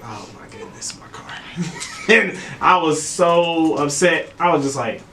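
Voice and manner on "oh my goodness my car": whispered retold shock; hushed dismay. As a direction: whisper it, stunned at the wreck. Retold dismay at wrecked car.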